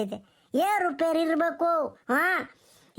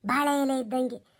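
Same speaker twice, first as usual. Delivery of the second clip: ordinary delivery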